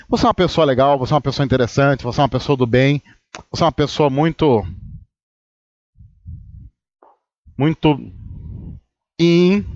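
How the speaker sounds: mock flattering friend. Pessoa elogiando antes de criticar: lightly voiced mock flattery. Imitating a sweet-talking critic.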